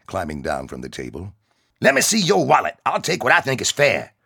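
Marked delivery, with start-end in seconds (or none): 1.8-4.1 loud, high menacing demand; bully him into handing over the wallet; threatening. Acted. Big soldier threatening the kid for his wallet. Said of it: "the behemoth threatened".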